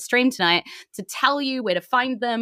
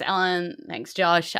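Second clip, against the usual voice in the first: ordinary talk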